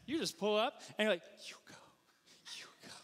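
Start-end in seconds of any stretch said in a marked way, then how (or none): 1.5-2.9 very soft, whispered offer; mock-courteous whisper, waving the other on; polite deferring. Shown as a demonstration. Missouri driver at a four-way stop, whispering politely. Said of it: "you're there to make friends".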